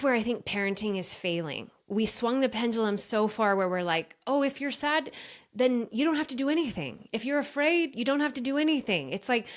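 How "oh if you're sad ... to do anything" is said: mocking permissive parent.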